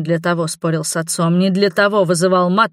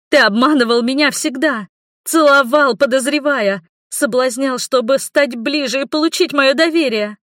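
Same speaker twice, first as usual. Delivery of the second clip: shouting; 7 semitones higher than usual. A narrator's reading. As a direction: hurl the charges at him. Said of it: "Я уже кричала".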